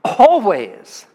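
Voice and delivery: loud, falling "Always."; proclaiming. Acted. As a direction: ring out the single word "Always.". Answering his own question.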